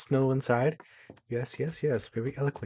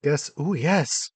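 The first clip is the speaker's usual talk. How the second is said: excited; 4 semitones higher, 8 dB louder, slower than usual. Real, not acted. Delighted find while decorating.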